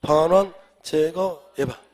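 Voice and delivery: 크게 끊어 외치는 암기 구호; 학생들과 복창하듯 힘주어; 구호처럼 선창. Shown as a demonstration. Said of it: "시작". (힘찬 복창)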